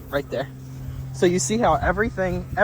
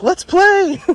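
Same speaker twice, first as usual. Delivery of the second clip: high, loud mock-excited invitation; imitated playful excitement; 14 semitones higher, 5 dB louder than usual. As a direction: act out a dog eager to play. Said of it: "then it was like".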